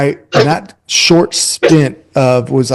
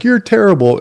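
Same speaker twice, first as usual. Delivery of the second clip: blunt put-down; 7 semitones higher than usual. Retold or imitated. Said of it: "I wasn't like".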